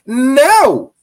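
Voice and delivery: high, loud scolding; firm loud no. Real, not acted. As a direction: shut it down loudly. Scolding Flair's behavior.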